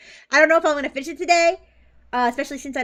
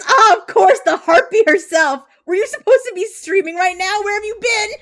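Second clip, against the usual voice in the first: 1 excited reading; 8 semitones higher, 10 dB louder than usual. Retold or imitated. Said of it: "He says".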